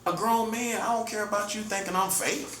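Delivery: ordinary talk, nothing marked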